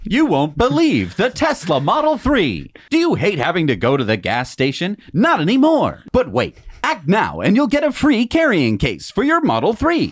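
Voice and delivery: high, loud, hyped TV pitchman; infomercial voice. Pretend. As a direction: sell it like a late-night infomercial. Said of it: "if you wanna do an infomercial".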